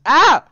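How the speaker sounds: sudden loud "ah"; grito repentino. Real, not acted. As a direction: blurt out a loud yelp. Exclama de golpe al intentar hablar. (grito corto y fuerte)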